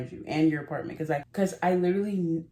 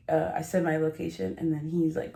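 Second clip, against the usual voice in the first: ordinary delivery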